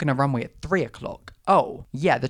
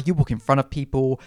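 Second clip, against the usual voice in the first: ordinary delivery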